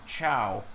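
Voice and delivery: "Chow" spoken as C-H-O-W; imitated mispronunciation. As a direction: say "Chow" with ch, rhyming with cow. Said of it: "pronounced it like C H O W".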